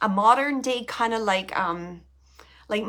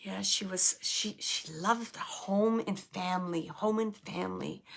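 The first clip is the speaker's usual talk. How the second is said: hushed relaying; 4 semitones lower, 6 dB quieter than usual. Real, not acted. Medium quietly relaying spirit.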